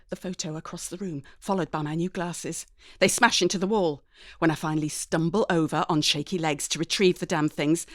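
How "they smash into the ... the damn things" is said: usual speaking voice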